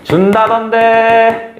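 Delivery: slyly fishing, drawn out at the end; sly probing. Shown as a demonstration. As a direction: sound him out casually, trailing off slow. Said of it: "이렇게 슬쩍 떠봅니다".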